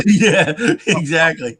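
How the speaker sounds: loud, real laugh through the words; laughing agreement. Real, not acted. Storyteller answering a teasing question, laughing. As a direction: agree while cracking up.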